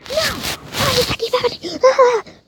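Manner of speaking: high, loud, drawn-out wail; frustrated outburst. Real, not acted. Gamer raging at lag, frustrated high cry. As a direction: wail the question in exasperation.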